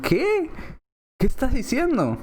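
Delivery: high-pitched, incredulous question; baffled reaction. Acted. Soldiers hearing a botched trumpet call, baffled. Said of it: "todos se quedaron".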